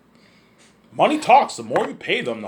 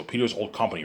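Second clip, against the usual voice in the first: usual speaking voice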